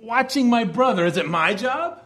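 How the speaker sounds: "Watching my brother" lifted high, then "is it my job?" asked; English emphasis. Shown as a demonstration. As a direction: put the weight on "Watching my brother". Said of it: "try to put that emphasis in English".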